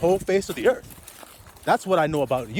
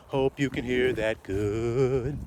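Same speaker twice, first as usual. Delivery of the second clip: slow quiet aside; 5 semitones lower, 4 dB quieter, much slower than usual. Real, not acted. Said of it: "repeating slowly".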